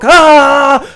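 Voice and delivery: pain scream. Acted.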